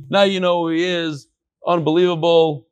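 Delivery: ordinary delivery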